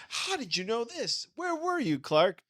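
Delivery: hammed-up high-pitched radio sidekick grilling Clark; mock suspicious questioner. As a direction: squeak out the suspicious questions of a side character. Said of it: "they would say, like".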